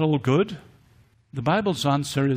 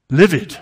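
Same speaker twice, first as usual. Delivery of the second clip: Livid repeated much louder, punched hard; emphatic livid; 3 semitones higher, 10 dB louder than usual. Acted. Preacher stressing Jesus' anger to the congregation: loud emphatic Livid. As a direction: hammer the word Livid on its own. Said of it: "Jesus was livid".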